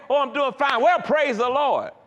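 religious stock response. Retold or imitated. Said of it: "I responded religiously".

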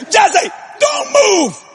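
high, slow, drawn-out warning; imitated alarmed wife. Wife peering under house warns husband, imitated alarm. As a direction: freeze him with a stretched-out warning.

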